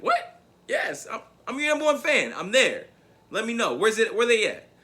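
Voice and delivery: high, loud, indignant grandad; offended grandfather. Retold or imitated. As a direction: play the grandad, almost offended and raising his voice. Grandfather almost got offended.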